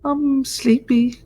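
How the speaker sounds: put-on high, slow sleepy child; drowsy little kid voice. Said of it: "the little baby voice".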